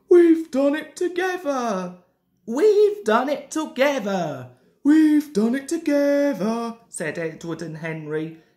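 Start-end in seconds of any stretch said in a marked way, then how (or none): 0.1-6.8 loud, high victory cry; triumphant. A narrator's reading. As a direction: crow it out, louder and higher. Engines cheer their shared success.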